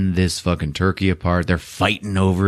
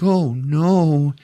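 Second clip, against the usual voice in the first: higher, drawn-out oh no; mild mock dismay; 7 semitones higher than usual. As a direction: voice a kid's dismay. Retelling Ralphie's dismay at crunched glasses.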